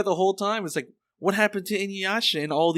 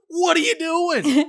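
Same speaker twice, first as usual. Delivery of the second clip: much higher, slow, incredulous mock shout; voicing Inuyasha; 12 semitones higher, 3 dB louder, slower than usual. Inuyasha grabbing Miroku's wrist, incredulous high yell. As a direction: yell it at your friend in disbelief. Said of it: "and then he slaps the hand out and goes".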